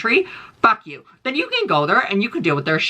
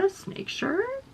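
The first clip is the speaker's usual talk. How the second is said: surprised squeak; 9 dB quieter, much slower than usual. Real, not acted. Surprised at tweet image.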